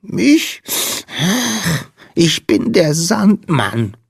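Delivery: high, slow sniffly voice; sniff, then introduce yourself slowly in a squeak; sniffling little creature. A narrator's reading. Tearful Sandman introducing himself to Lina, high sniffle. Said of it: "schniefte der Wicht".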